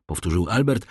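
usual speaking voice

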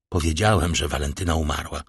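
low, grave pronouncement; solemn and menacing. A narrator's reading. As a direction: state it gravely, with a threat underneath. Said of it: "uroczyście i z groźbą w głosie". (uroczysty, niski głos z groźbą)

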